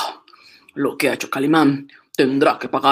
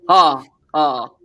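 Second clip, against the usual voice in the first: usual speaking voice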